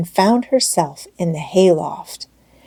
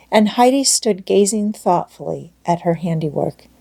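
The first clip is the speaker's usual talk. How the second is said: plain reading voice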